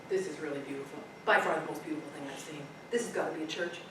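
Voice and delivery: soft, low, awestruck murmur; hushed awe. A narrator's reading. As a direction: breathe it out in wonder. Son admires tent, hushed.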